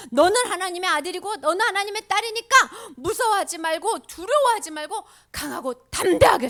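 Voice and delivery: put-on rally the children to be brave; rousing exhortation. Voices Jesus urging children to courage.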